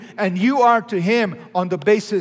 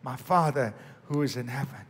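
soft reverent quote; 4 semitones lower, 7 dB quieter than usual. Retold or imitated. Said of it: "He said".

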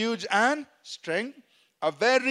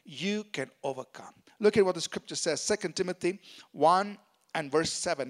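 usual speaking voice

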